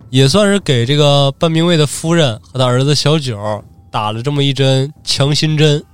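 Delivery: ordinary delivery, nothing marked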